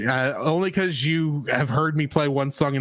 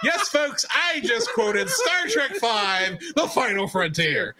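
laughing announcement; 7 semitones higher, much slower than usual. Real, not acted. Announcing his quote, laughing.

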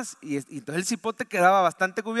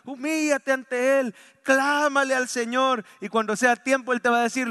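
full-force preaching; 6 semitones higher, 4 dB louder than usual. Acted. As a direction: preach it out over the congregation.

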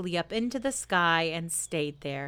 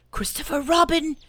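loud whisper; 10 semitones higher, 6 dB louder than usual. A narrator's reading. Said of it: "he said in a loud whisper".

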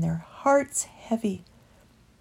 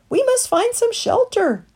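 much higher, louder urgency; urgent call; 6 dB louder than usual. Acted. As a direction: push them to shelter fast. Storm breaking.

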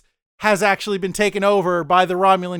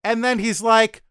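ordinary talk, nothing marked

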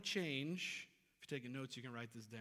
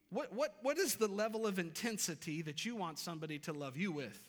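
ordinary talk

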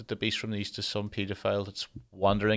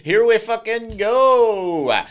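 much higher, much louder laughing whoa, then slow; mock shock; 13 semitones higher, 12 dB louder, much slower than usual. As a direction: squeal it in delighted disbelief. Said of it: "And I was like".

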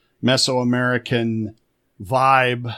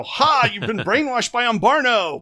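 mocking player; 10 semitones higher than usual. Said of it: "the P Cs are gonna go".

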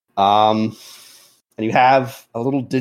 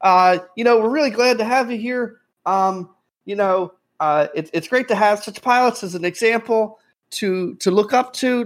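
put-on eager young man; 10 semitones higher than usual. Eager young man praises pilots.

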